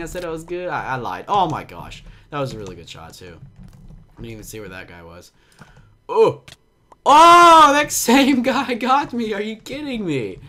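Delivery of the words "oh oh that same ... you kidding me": high, loud disbelieving yelp; loud, high squawk of disbelief; shocked outburst. Real, not acted. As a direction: cry out at getting caught again. Killed by same player again.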